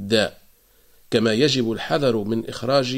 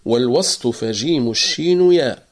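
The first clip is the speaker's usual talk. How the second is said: والوسط فجيم الشين ياء chanted in tajweed recitation; قراءة مرتلة للبيت; recited verse; 4 dB louder than usual. Shown as a demonstration. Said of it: "قول ابن الجزري".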